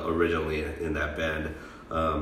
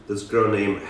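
ordinary talk, nothing marked

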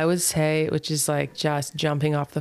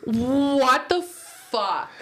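high, very drawn-out exclamation; long, high incredulous cry; outraged disbelief; 8 semitones higher, much slower than usual. Real, not acted. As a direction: stretch each word in affronted shock. Mock outrage at partner's backhanded remark.

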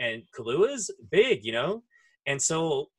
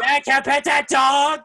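put-on much higher, loud toddler shout; 12 semitones higher, 9 dB louder than usual. Toddler shouting to his dad to pet the dog. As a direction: shout for dad like an eager tot. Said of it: "Kid did not fucking hear that".

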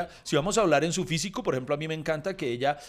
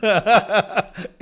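raised, louder voice breaking into laughter; laughing joke; 3 semitones higher, 5 dB louder than usual. Real, not acted. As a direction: get the joke out while laughing. Bromea riendo con "hubieron.

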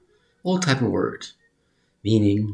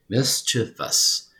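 MISCHIEVOUS drawn out in separate syllables; slow syllable-by-syllable; much slower than usual. Shown as a demonstration. Breaks the taught word into syllables.

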